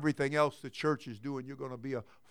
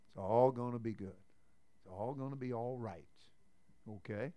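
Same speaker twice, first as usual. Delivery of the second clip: soft reassurance; 7 semitones lower, 13 dB quieter than usual. Real, not acted.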